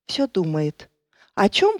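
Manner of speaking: ordinary delivery, nothing marked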